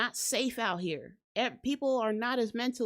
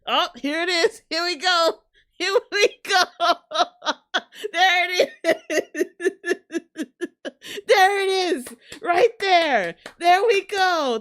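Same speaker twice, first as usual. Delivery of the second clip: much louder, higher, laughing; excited celebration; 6 semitones higher, 10 dB louder than usual. Real, not acted. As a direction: cheer like a prediction came true.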